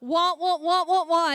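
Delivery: muted trombone wah wah; Charlie Brown's teacher. Retold or imitated. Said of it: "What does Charlie Brown's teacher sound like".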